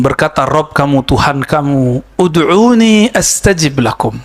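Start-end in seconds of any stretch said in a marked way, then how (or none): 2.2-4.2 recitation. Acted. Recites Quran verse slowly.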